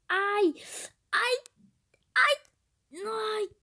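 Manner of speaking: ai ai ai agudos e altos; gritos de dor. Real, not acted. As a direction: gritar de dor a cada batida. Queda repetida no jogo. (gritos agudos de dor)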